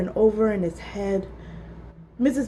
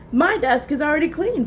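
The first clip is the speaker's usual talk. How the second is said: proudly; 6 semitones higher than usual. A narrator's reading. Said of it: "Philip said proudly".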